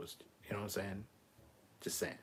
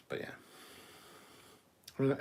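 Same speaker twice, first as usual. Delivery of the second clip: ordinary talk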